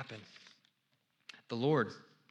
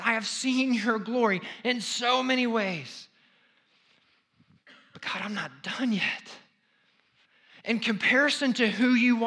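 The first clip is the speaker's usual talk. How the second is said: loud, higher preaching as Moses; fervent preacher voicing Moses; impassioned plea; 6 semitones higher, 7 dB louder than usual. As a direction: cry out everything God has done. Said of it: "what Moses is basically saying is".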